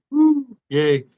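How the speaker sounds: mock cheer. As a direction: fake a tiny celebration.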